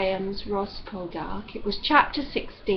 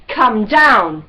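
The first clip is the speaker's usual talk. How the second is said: loud final command; loud order; 11 dB louder than usual. A narrator's reading. Ross's last loud order to Demelza. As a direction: bark it up the tree.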